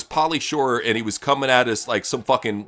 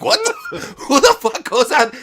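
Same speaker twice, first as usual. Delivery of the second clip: mock disbelief; 13 semitones higher, 3 dB louder, slower than usual. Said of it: "I'm like".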